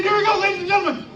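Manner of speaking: high, fast showman build-up; excited hype. Real, not acted. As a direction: fire up the crowd like a game-show host. Host hyping audience, starting machine.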